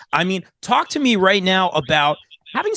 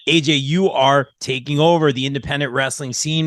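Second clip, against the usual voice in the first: ordinary talk, nothing marked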